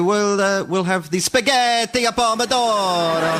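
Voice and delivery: high, drawn-out hammy Italian food name; slow, far-too-Italian restaurant order; mock Italian accent. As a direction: mimic parents ordering in overdone Italian. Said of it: "they put on this Italian accent".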